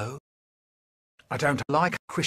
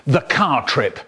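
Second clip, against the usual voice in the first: ordinary talk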